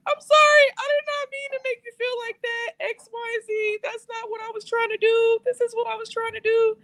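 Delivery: very high, squealed whiny pleading; hammed-up wail, squeaking out the apology; mock crying apology. Mocking her own tearful apology.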